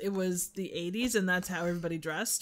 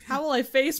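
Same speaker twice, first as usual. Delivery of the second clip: normal talking voice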